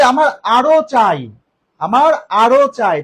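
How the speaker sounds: slow, loud example with আরো drawn out; teacher hammers আরো slowly and loudly; stress on আরো. Shown as a demonstration. Teacher demonstrates emphasis to students, slow and loud on আরো. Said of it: "আরো পরিমাণ বোঝাচ্ছে না".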